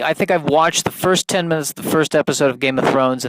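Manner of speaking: normal talking voice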